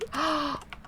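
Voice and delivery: quick startled intake of breath; sharp gasp. Real, not acted. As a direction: gasp as if something just happened. Startled by ghost activity.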